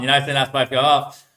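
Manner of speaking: normal talking voice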